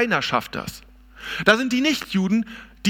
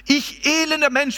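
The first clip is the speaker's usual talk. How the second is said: higher and quicker second cry, peaking on "elender"; lament; 7 semitones higher than usual. Retold or imitated. Preacher repeating Paul's lament higher and quicker. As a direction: repeat "elender" pitched up like a plea. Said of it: "da ist die Klage dann".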